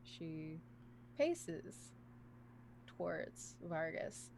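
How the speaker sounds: low, slow, hushed scene setting; game master narration. A narrator's reading. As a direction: set the scene softly with long pauses.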